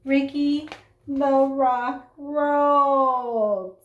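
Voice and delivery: plain reading voice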